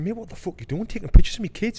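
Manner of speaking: high, loud retold challenge; rounding on the stranger, furious; angry confrontation. Dad confronting a stranger on the beach, angry and loud. Confronting man photographing his kids.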